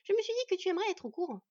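high, quick female character voice; brisk, high-pitched wife passing on news; wife's voice. A narrator's reading. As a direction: inform your husband, fast and high. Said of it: "l'informa sa femme".

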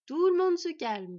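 stern, drawn-out call to order; growled order. Acted. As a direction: scold them firmly into calm. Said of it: "gronda Fenn".

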